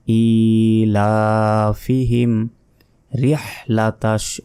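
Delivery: ইলাফিহিম with long "ii" and "laa"; slow teaching recitation of ইলাফিহিম রিহলাতাশ; measured elongation. Shown as a demonstration. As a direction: hold the long vowels of ইলাফিহিম, then separate রিহলাতাশ. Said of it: "লা এক second টান হবে".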